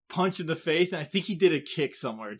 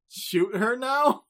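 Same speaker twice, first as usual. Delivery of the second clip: ordinary delivery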